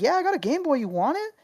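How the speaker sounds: put-on high old-lady voice, offering; tiny old lady. Old woman repeating her Game Boy offer, high old-lady voice. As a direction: squeak it out like a sweet grandma. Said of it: "And she's like".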